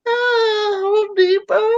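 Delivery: high, far-off sheepish squeak; sheepish son. Retold or imitated. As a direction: fake an innocent answer.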